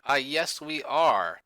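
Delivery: usual speaking voice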